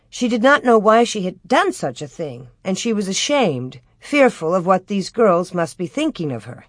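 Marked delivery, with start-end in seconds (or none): none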